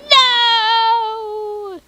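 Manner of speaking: high, loud, drawn-out wail; hammed-up scream. Hero losing his sister, hammed-up wailing scream. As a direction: scream a melodramatic cry into the mic. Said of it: "I can scream into this mic".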